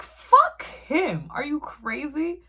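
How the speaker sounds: mock outraged retort, high then loud; retelling telling mom off; furious retort. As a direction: snap back in disbelief. Retold outburst at mom.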